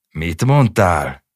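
loud, slow roar. Acted. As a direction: bellow it in rage. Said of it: "Ordította Józsi".

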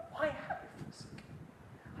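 slow, soft whisper; whispered cry. Acted. Preacher voicing Jesus dying, slow whisper. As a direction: breathe the words out slowly. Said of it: "he died crying".

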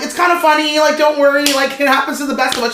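high, loud re-enacted reassurance; awkward reassurance. Guest to apologetic girlfriend, awkwardly reassuring. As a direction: imitate someone over-eagerly smoothing things over.

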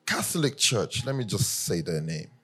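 ordinary talk, nothing marked

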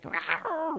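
high mock monster growl; a little beast roaring out; playful rawr. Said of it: "the control freak in me".

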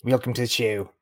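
lightly put-on South African accent. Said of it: "fun name to say in a South African accent".

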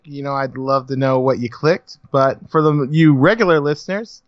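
ordinary delivery

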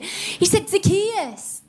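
put-on reading to kids, tender; tender naming. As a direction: whisper the secret name to children. Telling kids Jesus called his name.

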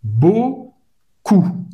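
"Beaucoup" with a French U in the second syllable and no P; "Beaucoup": O then a rounded French U, final P silent; French vowel demo. Shown as a demonstration. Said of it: "O U em francês tem o som da letra U". ("Beaucoup" com U francês e P mudo)

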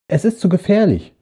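high call after someone leaving. Acted. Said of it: "riefen sie ihm hinterher". (ihm hoch hinterhergerufen)

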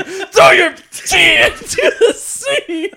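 high, loud, slow quote through laughter; laughing mock shout. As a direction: mimic his angry shout while laughing. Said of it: "You got so pissed off".

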